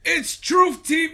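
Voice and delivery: loud high correction; shouting. Real, not acted. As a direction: shout the name out.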